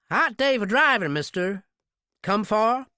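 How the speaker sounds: friendly small-town nosiness; drugstore clerk. Acted. Drugstore clerk chats up the stranger buying cigarettes.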